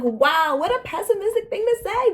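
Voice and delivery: high, loud mock gasp of disbelief; a put-on voice of shocked disbelief. Retold reaction to roommate's remark.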